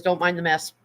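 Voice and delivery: normal talking voice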